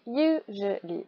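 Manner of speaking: "usually" slowly broken into three syllables. Shown as a demonstration. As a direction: separate "usually" as you, je, lee. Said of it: "Trois syllabes".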